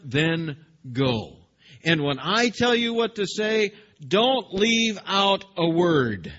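slow, loud stern parent laying down rules; parental tone. Acted. God as stern parent commanding Jeremiah, slow and loud. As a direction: scold like a parent who means it. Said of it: "that parental tone".

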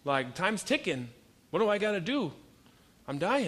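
hammed-up panic of a dying man; mock dying urgency. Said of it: "I'm like".

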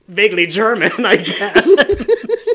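Miss Ruse. Shown as a demonstration. Teacher plays the puppet who yells at children, mock German. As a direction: rude puppet in a hammed-up German accent. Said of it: "Miss Ruse is vaguely German".

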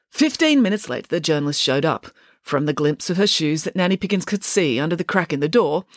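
plain reading voice

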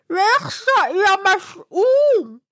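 high, slow, terrified shout; frightened cry. Acted. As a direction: shriek it in panic at the dog. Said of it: "فزع وقال".

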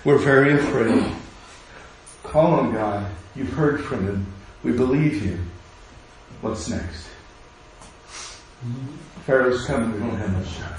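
soft, quiet, steady trusting voice; calm trust. Retold or imitated. Israelites calmly trusting Moses, in a soft voice. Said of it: "what they could have said".

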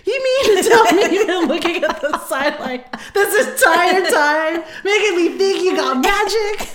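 high, loud, laughing indignation; hammed-up scandalised protest; mock outrage at dad. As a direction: accuse him as if betrayed, half laughing. Acted outraged confrontation of dad.

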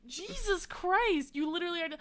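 high, loud exasperation; worked-up protest to a friend; exasperated outburst. Real, not acted. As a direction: pitch the voice up in heated disbelief. Exasperated in argument over scores.

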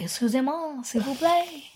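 put-on soft, lilting French accent; French accent rising at the end. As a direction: play a polite French speaker, softly. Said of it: "the next time you're like".

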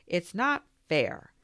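slow, loud grumbled complaint. A narrator's reading. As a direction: mope it out, slowly and sulky. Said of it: "Eagle grumbled".

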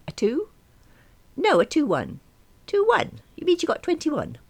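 put-on high, puzzled elderly voice; a bewildered old mum; confused old mother.

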